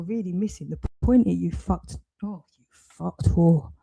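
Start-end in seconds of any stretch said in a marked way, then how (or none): none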